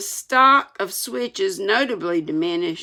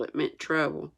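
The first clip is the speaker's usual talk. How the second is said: plain reading voice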